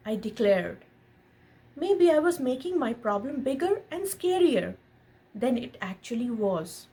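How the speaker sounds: gentle storybook read-aloud; lightly animated picture-book narration; reading to a child. A narrator's reading. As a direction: read it like a bedtime story to your son. Reads picture book to son.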